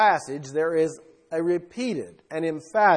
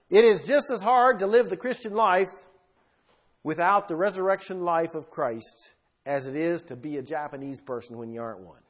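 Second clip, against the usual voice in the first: ordinary delivery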